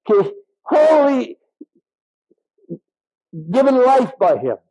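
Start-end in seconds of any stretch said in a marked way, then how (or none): 0.6-1.4 HOLY high and stretched slowly; emphatic holy. Real, not acted. Preacher calling listeners to life from God, emphatic. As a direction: draw out HOLY on a high pitch. Preacher repeats key word.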